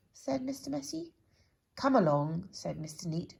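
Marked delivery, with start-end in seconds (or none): 1.7-2.5 loud brisk "come along"; nanny-like order; bossy command. Acted. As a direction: hustle him off. Bossy character.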